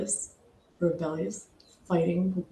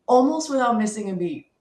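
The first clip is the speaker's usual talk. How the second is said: normal talking voice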